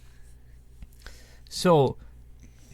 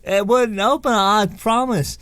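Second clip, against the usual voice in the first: mock English accent, higher and louder; light English accent, insisting; 9 semitones higher, 7 dB louder than usual. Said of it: "that's my English accent".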